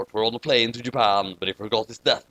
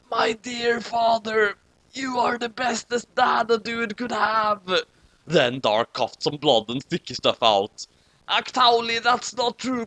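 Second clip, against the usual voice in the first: lightly put-on high voice; dying son voice; 7 semitones higher than usual. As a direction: give a hammed-up dying farewell.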